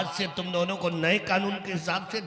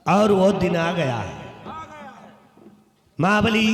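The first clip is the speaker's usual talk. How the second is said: booming, declaimed royal orders; loud stage command; 7 dB louder than usual. Acted. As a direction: proclaim it loudly to the whole court. King issuing orders onstage. (ज़ोर से मंचीय हुक्म)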